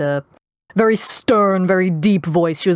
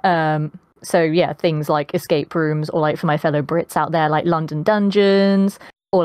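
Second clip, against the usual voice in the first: ordinary delivery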